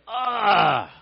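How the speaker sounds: loud, high anguished "ah"; wordless groan of turmoil. Acted. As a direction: let out the cry words can't express. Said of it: "words could not express what was going on".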